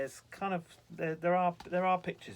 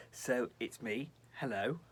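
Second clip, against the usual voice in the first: usual speaking voice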